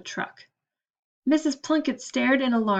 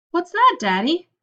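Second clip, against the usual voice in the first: put-on higher, louder child's voice; little girl; 7 semitones higher, 5 dB louder than usual. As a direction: sound like a curious little girl. Little daughter asks father.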